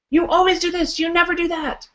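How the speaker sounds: high, insistent absolutes; ego voice. Retold or imitated. Speaker voicing the ego's absolute claims, high and insistent. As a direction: nag in high, sweeping absolutes. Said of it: "the ego speaks in absolutes".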